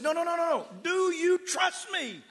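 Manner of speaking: high, loud, slow demand; insistent shout. Retold or imitated. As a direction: hammer the question home. Pastor shouting at drowning man.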